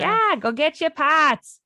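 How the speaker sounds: mock Boston accent, loud, high and drawn out; hammed-up Boston podcaster calling out. Podcast host imitating Boston hosts, hammed-up Boston accent. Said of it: "they're out east in".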